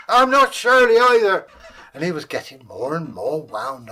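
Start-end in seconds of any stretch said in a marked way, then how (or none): 0.1-1.5 high, loud squeaky protest; outraged little man squawk; indignant dwarf. Acted. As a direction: bristle at the insult.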